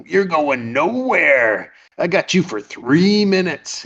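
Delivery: slow, high, swooping taunt; taunting cage fighter. Retold or imitated. Crazy fighter warns Peter he is trapped, taunting. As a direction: drag out the key words menacingly.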